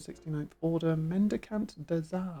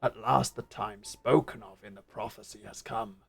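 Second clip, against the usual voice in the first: big burly beast man; 6 semitones lower than usual. Acted. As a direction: speak it like a huge hulking beast. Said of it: "Big burly beast man".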